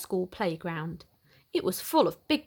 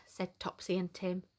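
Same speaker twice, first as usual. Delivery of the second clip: usual speaking voice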